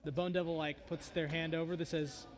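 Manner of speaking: normal talking voice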